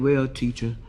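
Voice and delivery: put-on low-pitched little boy explaining; comically deep schoolboy voice; heavy voice. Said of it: "the one with the heavy voice".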